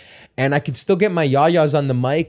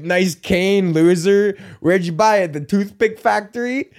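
mock old bully; 5 semitones higher, slower than usual. Old guy in the ad mocking another's cane, taunting. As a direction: jeer like a cranky old guy. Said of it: "and they're like".

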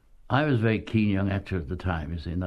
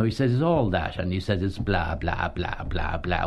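ordinary talk

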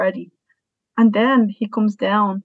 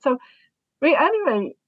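ordinary talk, nothing marked

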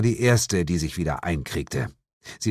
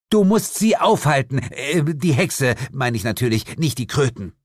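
higher-pitched, insistent cut-in, then backpedal; urgent interruption; 6 semitones higher, 3 dB louder than usual. A narrator's reading. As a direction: break in with an urgent demand. Jane cuts narrator off, urgent demand. (dringlich ins Wort fallen)

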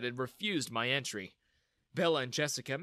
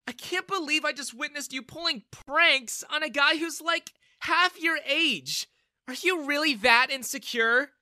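scornful scolding; 13 semitones higher, 7 dB louder than usual. Acted. Daughter scolding her father, scornful. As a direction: shame him for his cruelty.

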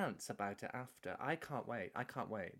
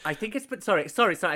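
1 loud interruption; 5 semitones higher, 10 dB louder, faster than usual. Real, not acted. Cuts in to correct.

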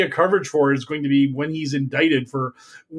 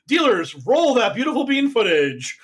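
announcer voice; 6 semitones higher than usual. Retold or imitated. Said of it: "room manager said".

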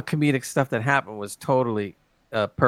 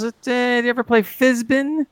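high, lightly acted bluff; Kirk; 10 semitones higher than usual. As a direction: improvise a made-up game as a tempting question. Retelling Kirk inventing card game.

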